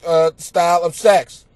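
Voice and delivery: usual speaking voice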